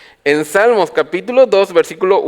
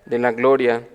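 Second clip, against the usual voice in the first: normal talking voice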